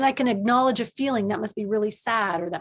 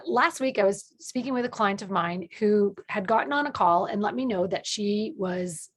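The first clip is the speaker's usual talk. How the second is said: ordinary talk, nothing marked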